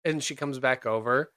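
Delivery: ordinary talk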